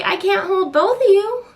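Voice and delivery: high, frazzled protest; exasperated cry. Retold or imitated. Mom overwhelmed by two crying babies, high and frazzled. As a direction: throw up your hands, overwhelmed. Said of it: "I'm like".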